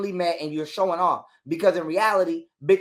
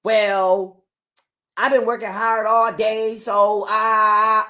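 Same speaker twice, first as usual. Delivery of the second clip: slow, mocking whiny voice, louder; a hint of the baby mother's voice; mocking Camille; 2 semitones higher, 5 dB louder, much slower than usual. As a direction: mimic her bragging slowly. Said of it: "Here come this bitch".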